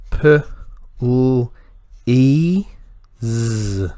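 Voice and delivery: p, l, e, z with clear gaps; sounded out. Shown as a demonstration. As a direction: isolate p, l, e, z, lifting p and e. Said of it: "do your segmenting".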